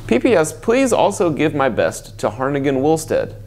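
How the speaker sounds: ordinary delivery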